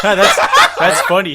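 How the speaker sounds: loud, high, laughing words; real laughing. Real, not acted. Co-host cracking up at the guest's joke, laughing loudly. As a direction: burst out laughing as you speak.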